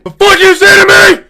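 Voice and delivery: high, loud, hostile snarl; aggressive honey badger. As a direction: bark it back like a picked fight. Honey badger snaps back.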